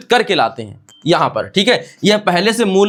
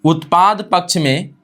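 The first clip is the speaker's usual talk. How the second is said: slow, with long pauses between phrases; slow dictation; 6 semitones lower, slower than usual. Shown as a demonstration. Teacher dictating a definition to students, slow and spaced. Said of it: "एक साथ लिख देते हैं".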